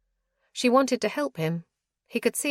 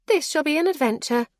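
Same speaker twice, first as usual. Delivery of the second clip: put-on higher, bright and cheerful girl; cheerful young friend, a touch louder; 8 semitones higher, 5 dB louder than usual. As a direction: sound delighted about the outing. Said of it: "Ella said cheerfully".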